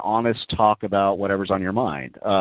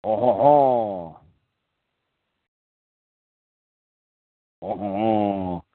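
hammed-up French-accented pope; slow, drawn-out mock French with "oh, ho, ho"; slower than usual. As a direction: put on an exaggerated French accent. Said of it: "does he speak with a French accent".

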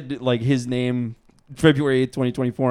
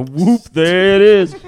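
a loud, higher, slow whoop of triumph; mock sex catchphrase; 6 semitones higher, 9 dB louder, slower than usual. Joking about what he'd say in bed, hammed-up whoop. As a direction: sing it out like a goofy celebration. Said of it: "I go".

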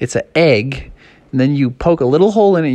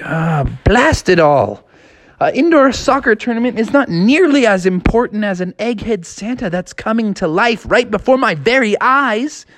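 put-on frustrated kid voice; 7 semitones higher than usual. Froggy frustrated.

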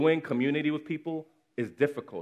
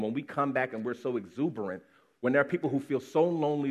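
normal talking voice

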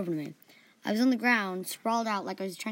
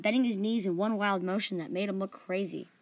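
ordinary delivery

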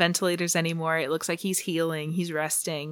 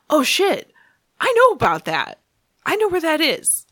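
higher, louder, mock-eager voice; excited realization; 9 semitones higher, 5 dB louder than usual. Ben realizes he knows the secret place, excited realization. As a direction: imitate someone suddenly remembering, pitched up.

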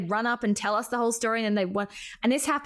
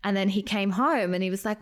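ordinary talk, nothing marked

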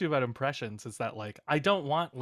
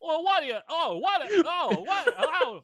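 much higher, flustered stammering star voice; Jimmy Stewart; 3 dB louder, slower than usual. Pretend. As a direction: fumble words like a startled old film hero. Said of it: "Jimmy Stewart falling downstairs".

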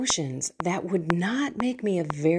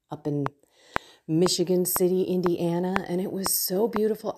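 normal talking voice

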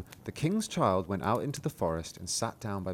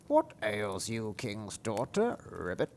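slow, softer croaking frog; a slow wet frog speaking up from the well; 5 dB quieter, slower than usual. Acted. Frog in the well speaking to the princess, slow croaky voice.

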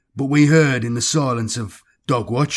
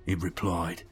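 ordinary delivery

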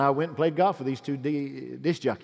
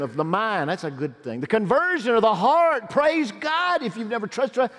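high, loud, urgent exhortation; full-force preaching; 5 semitones higher, 5 dB louder than usual. Real, not acted. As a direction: drive it home to the congregation.

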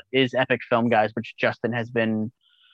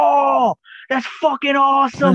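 much higher, louder, thrilled Australian-tinged exclamation; excited shout; 14 semitones higher, 4 dB louder, slower than usual. Retold or imitated. Director thrilled to meet horror fans, excited high shout. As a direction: belt it out like a delighted director. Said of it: "He was like".

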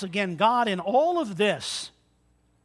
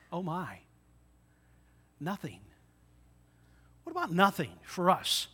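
ordinary talk

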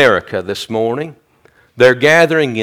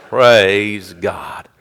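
ordinary talk, nothing marked

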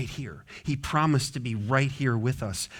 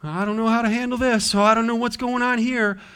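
put-on scared little kid; 8 semitones higher, 4 dB louder than usual. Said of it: "feel like that little kid".

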